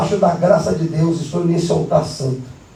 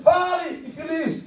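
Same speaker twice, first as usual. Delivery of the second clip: exasperated demand; 7 semitones higher, 6 dB quieter, slower than usual. Retold or imitated. Pastor presses the man.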